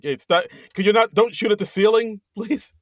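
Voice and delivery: half-laughing exasperated plea; mock pleading. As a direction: beg politely over the chaos, laughing at the end. Joking as boss on intercom.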